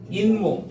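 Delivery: 잇몸 as in-mom, with n before m; demonstrated 잇몸. Shown as a demonstration. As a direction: sound a clear n in 잇몸. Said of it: "이렇게 발음돼요". ("인몸"으로 니은 소리를 넣어서)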